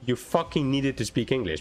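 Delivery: ordinary delivery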